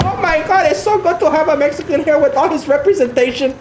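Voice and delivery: mocking fan. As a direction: ham up a fawning fan. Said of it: "I'm not one of those guys".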